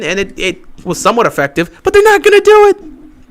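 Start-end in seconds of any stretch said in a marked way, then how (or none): 1.8-3.3 high, loud and fed up; exasperated shout. Real, not acted. Fan ranting at his team's coaches, exasperated. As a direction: throw it at the screen in frustration. Fan venting about play calling.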